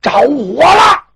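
loud high slow alarm yell; yelling fire. Acted. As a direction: cry out that the house is burning. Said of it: "有人大喊一声".

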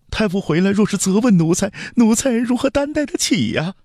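very high, pleading servant voice; frantic palace steward. Acted. Steward kneels before the prince to stop him, frantic. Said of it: "黄门总管一听就急了".

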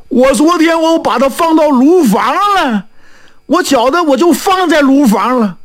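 high, fretful and whining; acted distress, close to tears; tearful blacksmith. Blacksmith explains to fortune-teller where he hid silver, tearfully. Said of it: "说着说着还哭上了".

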